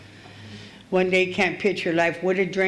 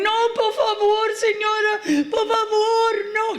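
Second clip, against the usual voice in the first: put-on high, pleading Mexican accent; Mexican lady pleading; 14 semitones higher than usual. Retelling the Mexican lady begging to keep her purse, high Mexican-accented plea. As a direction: beg in Spanish in a high Mexican voice. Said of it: "she's going".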